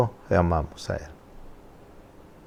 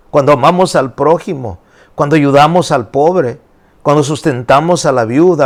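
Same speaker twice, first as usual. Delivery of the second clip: higher, louder preaching in rolling lists; prédica enérgica; 6 semitones higher, 5 dB louder than usual. Acted. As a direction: preach it out with rising force. Preaches at full force. (predicación con fuerza, voz alta)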